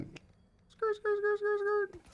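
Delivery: high, soft squeaky tire skid noise; tire screech. Pretend. Streamer mimicking car tires screeching off, high and squeaky.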